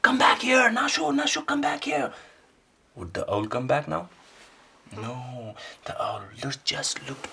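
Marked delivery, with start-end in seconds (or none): 0.0-2.1 much higher, loud desperate calling; raven calling after. Acted. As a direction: call out after someone flying off. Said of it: "the raven said".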